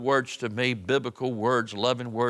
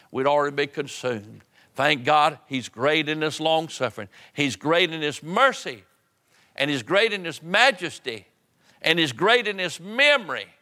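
raised, driving pulpit delivery; fervent preaching; 5 semitones higher, 3 dB louder than usual. Real, not acted. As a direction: build each praise to a ringing peak. Preaching at full force.

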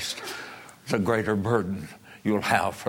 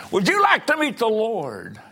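high, loud preacher's cry; impassioned preaching; 11 semitones higher, 5 dB louder than usual. Real, not acted. Preacher challenges the congregation about meeting the Lord, crying out loud. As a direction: throw the question out at full force. Same question repeated at full force.

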